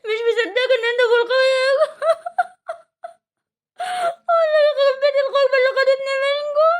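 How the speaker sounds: sobbing outburst, pitch way up; crying. Acted. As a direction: wail through tears at a reunion after long absence. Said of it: "ما تعيطيش".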